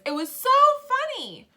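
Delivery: high, loud exclamation stressing "so"; full of feeling. Shown as a demonstration. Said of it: "I was really, had a lot of feelings".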